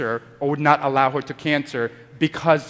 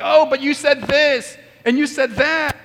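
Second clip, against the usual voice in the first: mock heated accusation, higher and louder; emotional quarrel; 10 semitones higher, 4 dB louder than usual. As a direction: play the worked-up spouse throwing blame back. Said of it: "A lot of people get so emotional".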